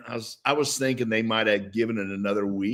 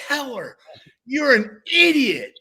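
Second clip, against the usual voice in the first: slow, higher mock taunt; teammates mocking; 12 semitones higher, 4 dB louder than usual. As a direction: rub it in, jeering. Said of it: "people are going".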